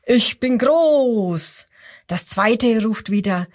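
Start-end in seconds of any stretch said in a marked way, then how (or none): 0.0-1.7 slow, drawn-out boast from the biggest crocodile; big crocodile boasting. Acted. Biggest crocodile shows off to the others, slow proud call. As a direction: stretch it out like a proud big beast. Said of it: "Und das erste ruft wieder".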